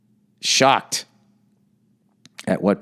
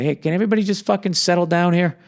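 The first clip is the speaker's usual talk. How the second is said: mock exasperated plea, higher and fast; hammed-up reasonable voice, raised pitch, rushed; voice of reason; 7 semitones higher than usual. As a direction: play the rational guy begging everyone to calm down. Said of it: "the rational people to be like".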